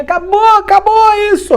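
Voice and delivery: very high, loud announcement; excited wrap-up. Real, not acted. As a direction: call out that it's over. Announcing the lesson is over.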